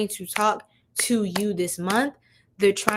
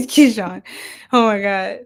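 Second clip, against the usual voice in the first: loud burst of real laughter; helpless loud laughing; cracking up; 3 semitones higher, 9 dB louder than usual. Real, not acted. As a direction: laugh hard, then gasp out the words. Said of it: "I'm crying".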